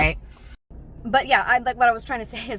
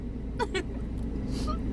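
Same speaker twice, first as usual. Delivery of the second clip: breathless laugh; 6 dB quieter than usual. Real, not acted. Laughing at mishap.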